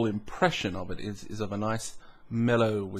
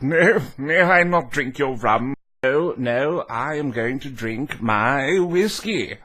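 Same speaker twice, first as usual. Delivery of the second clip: louder, hammed-up grand declaration; mock pompous refusal; 3 semitones higher, 6 dB louder, slower than usual. Refusing rum at the Bucks night bar, mock grand voice. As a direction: refuse the rum like a stubborn snob.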